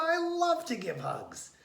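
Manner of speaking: high, lightly voiced gorilla; a gorilla eager to hug, lightly voiced. A narrator's reading. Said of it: "said the gorilla".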